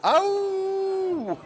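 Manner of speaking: long high howling cry; wolf howl. Pretend. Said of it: "A bit of a howl".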